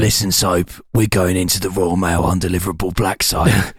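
low, gruff squad-leader briefing; gung-ho soldier. Acted. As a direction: growl it like a war-game commander. Military game briefing parody.

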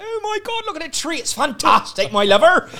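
high-pitched, hammed-up Somerset accent. Showing off a viral Christmas video, broad Somerset accent. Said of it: "I did, like, a Somerset accent".

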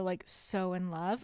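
usual speaking voice

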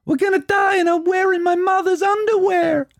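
high shouted film-character line. Acted. Host reads a film line for the quiz, high and shouted. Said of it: "What's that film?".

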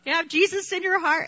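put-on girl at a stranger's door, eagerly asking about Jesus; eager young evangelist. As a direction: play her eager child self selling Jesus. Imitating her young evangelizing self.

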